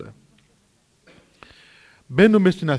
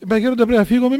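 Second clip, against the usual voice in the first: louder, higher pleading protest; insistent, raised-voice plea; urgent plea; 4 semitones higher, 6 dB louder, faster than usual. Retold or imitated. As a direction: press the elder anxiously. Acted urgent plea to elder.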